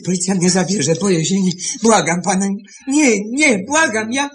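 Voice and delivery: high, rising panic; frightened pleading. Acted. Boy begging his master to spare him from prison, frightened. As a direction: plead through rising fear.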